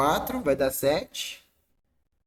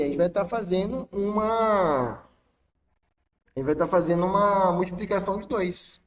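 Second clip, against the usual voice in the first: ordinary talk, nothing marked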